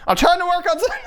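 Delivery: high, loud squealed complaint; exasperated yell. Pretend. Shooing off an interruption while working, exasperated yell.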